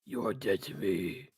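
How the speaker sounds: slow, low and grave; deep slow mock threat. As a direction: intone it like a doom. Echo in deep voice.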